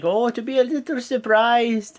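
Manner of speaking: put-on a clumsy try at Becky's Irish accent; bad Irish accent. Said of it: "I'm terrible with accents".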